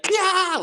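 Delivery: high sharp kung fu cry; karate yell. Retold or imitated. Boy leaping at his dad, high karate yell. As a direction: leap in with a martial arts shriek. Jumping flying kick at dad.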